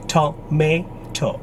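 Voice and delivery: "tomato" slowly as to-may-to; American pronunciation. Shown as a demonstration. Teacher showing how Americans say it, American pronunciation. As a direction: say "tomato" in three beats with MAY. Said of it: "For Americans, the syllable is".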